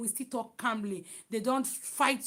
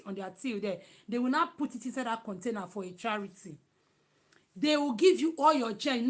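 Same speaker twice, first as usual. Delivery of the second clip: ordinary delivery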